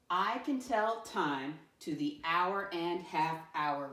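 slow, measured model reading; read-along. Shown as a demonstration. Teacher reads the statement to pupils, slow read-along. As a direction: read it slowly for learners to follow.

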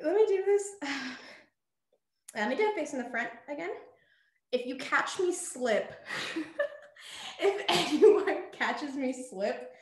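usual speaking voice

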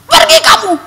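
loud, high rebuke with a scowl; commanding shout. Retold or imitated. Girl rebuking the figure with ugliest face, loud high shout. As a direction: drive the spirit away. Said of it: "saya kasih muka paling jelek".

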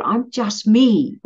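slow, high, self-satisfied I'm just me; proud and stubborn, drawn out slow; cocky self-talk. Retold or imitated. Past self to herself, smugly sure of herself. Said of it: "just thought".

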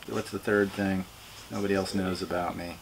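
ordinary talk, nothing marked

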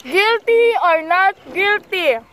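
slow dramatic quiz-host delivery; slow game-show announcing. Acted. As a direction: drag out guilty or not guilty.

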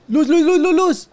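loud high panicked repeats; panicked calling. Real, not acted. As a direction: call for light in a panic. Pide la luz con pánico. (llamada con pánico)

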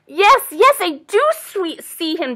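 high, loud excited squirrel; chattering squirrel. A narrator's reading. Squirrel answers doe in story, chattering loudly. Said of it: "chattered the squirrel".

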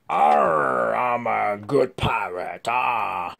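slow growly pirate with a shout. Pretend. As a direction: ham it up as a pirate. Voicing the toy pirate.

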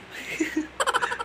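very high breathless giggle; a squeaky airy laugh; breathy giggle. Real, not acted. Owner giggling at the dog, high airy laugh.

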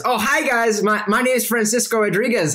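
a silly high-pitched dude greeting guests; Francisco Rodriguez. Acted. Odd host greets the vampire guests at his table, in a high goofy voice. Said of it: "he looks at you all and says".

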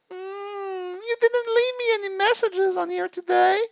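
high, whimpering mock sob; mock crying. Hammed-up girlfriend crying over missing messages. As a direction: whine it out with a fake cry.

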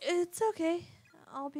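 high and loud, pushing cheer; forced brightness. Acted. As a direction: insist it's fine, too brightly. Daughter reassuring her apologizing mother.

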